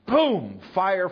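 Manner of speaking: sudden high, loud burst; dramatic boom. Real, not acted. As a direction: hit it like the fire crashing down. Fire falls in the story.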